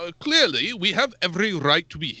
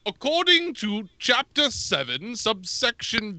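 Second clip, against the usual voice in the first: higher, fussy bureaucratic recital; pompous legal clerk; 5 semitones higher than usual. Acted. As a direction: bluff through legal jargon like a self-important clerk. Reginald cites space law.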